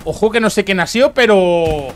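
high, loud heads-up; sudden alarm. Real, not acted. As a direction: call it out in surprise.

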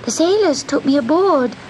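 high little kitten voice; pleading kitten. A narrator's reading. Kitten explaining himself to the sea witch, high pleading. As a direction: protest high and small, like a kitten.

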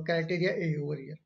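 ordinary talk, nothing marked

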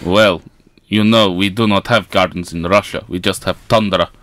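bad Russian accent for a comedy sketch. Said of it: "Accent change to Russian".

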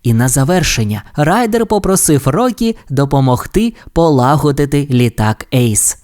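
ordinary delivery, nothing marked

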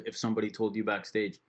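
ordinary delivery

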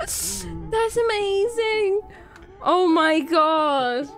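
slow, drawn-out, overcome with emotion; teary amazement. Real, not acted. Gamer overcome by a funny, sad game scene, teary amazement. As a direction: stretch the words out through happy tears. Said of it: "I'm tearing up 'cause it's so funny".

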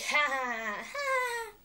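loud fake laugh leaping high; weird creepy laugh. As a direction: imitate a crowd cracking up, creepily. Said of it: "That's probably a weird laugh. Creepy, probably".

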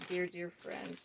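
ordinary talk, nothing marked

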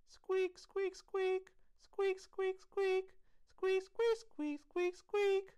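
very high, loud rhythmic squeaks; piercing squeaky beat; squeaking jingle bells. A narrator's reading. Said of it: "It sounded like jingle bells".

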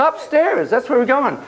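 excited cheer. Acted. Play therapy with toy house.

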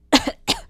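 harsh rising cough; coughing fit. Acted. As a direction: cough it out hard. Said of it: "Phoenix began coughing up a storm".

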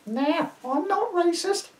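high, slow crone voice; cackling old crone. Acted. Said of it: "in my crone voice".

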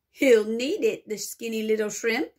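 ordinary delivery, nothing marked

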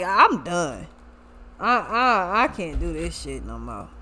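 low, fading, worn-out voice; mock exasperated giving up. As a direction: trail off low and soft, fed up. Acted exasperated man giving up.

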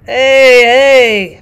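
loud, gleeful hey hey; a trapper slapping his thighs in delight; gleeful cry. Acted. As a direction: whoop it out with glee. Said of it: "Francois cried, slapping his thighs gleefully".

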